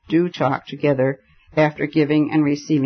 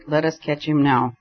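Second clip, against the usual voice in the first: usual speaking voice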